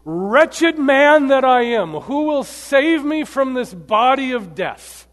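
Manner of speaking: loud, high, drawn-out lament; preacher's full-voiced plea of a wretched man; Paul's anguished cry. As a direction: cry out slowly in despair. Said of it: "this is the way he writes it".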